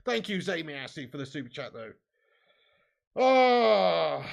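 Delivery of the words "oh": long, loud "oh" of dismay; real cry of frustration; loud groan. Real, not acted. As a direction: let out a drawn-out, loud groan. Reacting in despair to the match.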